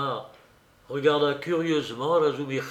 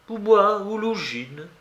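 ultimatum; 7 semitones higher, 4 dB louder than usual. Acted. Raskolnikov's ultimatum to his sister.

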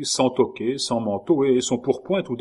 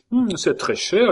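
teasing; 10 semitones higher than usual. A narrator's reading. Caylus mocking Schomberg.

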